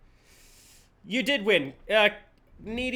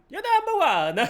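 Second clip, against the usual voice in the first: high, louder mock Mario; failed Mario impression; 13 semitones higher, 5 dB louder than usual. Streamer straining for a Mario voice. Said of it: "I can't Mario".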